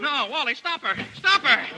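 high, alarmed cry; panicked yell. Acted. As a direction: shout in a panic. Editor panics as she runs.

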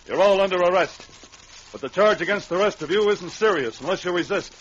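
ordinary talk

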